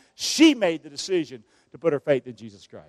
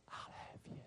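whispered reply, barely there; soft whisper; 32 dB quieter than usual. Acted. Preacher voices God answering the listener, whispered. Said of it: "what he's gonna say to you".

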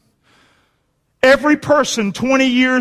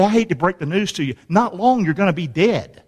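usual speaking voice

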